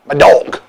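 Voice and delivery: imitated southern drawl on "dog". Retelling neighbor's answer about Jasper, drawled "dog". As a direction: draw out "dog" with a rounded southern vowel, like "dohlg". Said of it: "Why are you saying it like that?".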